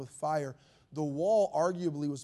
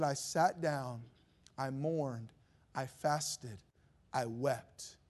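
lent, plus doux, une pointe de deuil; égrener chaque mot doucement; mild mourning; 4 semitones lower, 6 dB quieter, much slower than usual. Retold or imitated. Retells Nehemiah's mourning.

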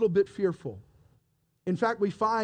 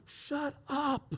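high, very soft urgent hush; hushed shushing; 5 semitones higher, 8 dB quieter than usual. Acted. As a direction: silence them without being overheard. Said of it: "keep your voices down".

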